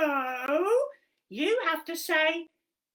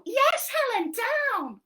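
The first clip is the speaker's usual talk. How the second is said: high, loud cheeky monkey voice; squeaky puppet monkey helping Helen; 11 semitones higher, 6 dB louder, slower than usual. Acted. Monkey puppet explaining a game to Helen, high and bouncy.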